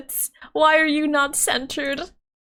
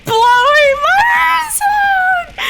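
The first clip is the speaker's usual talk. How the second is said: high, loud, stretched-out yell; real shout; 7 dB louder than usual. Real, not acted. Streamer yelling as the noise blares in her ears. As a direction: yell it over the blaring noise. Audio too loud, she yells.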